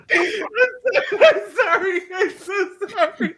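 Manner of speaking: high-pitched words squeezed through real laughter; helpless laughter. Real, not acted. Podcaster losing it at co-host's impression, helplessly laughing. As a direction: apologize while cracking up.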